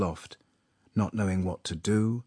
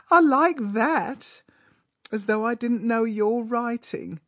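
put-on very high-pitched, affected girl; affected shriek; 4 dB louder than usual. A narrator's reading. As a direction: squeal it coyly at a suitor. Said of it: "giggled the girl with an affected shriek".

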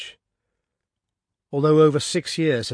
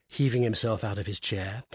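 ordinary delivery, nothing marked

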